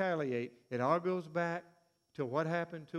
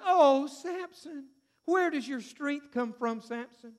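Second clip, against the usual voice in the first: Delilah coaxing; 7 semitones higher, 4 dB louder, slower than usual. Retold or imitated. Delilah coaxing Samson for secret.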